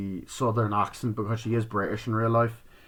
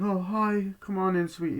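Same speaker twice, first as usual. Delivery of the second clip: put-on sweet old lady; 9 semitones higher than usual. Old woman Pearl welcomes visitor.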